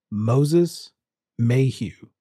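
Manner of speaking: ordinary talk, nothing marked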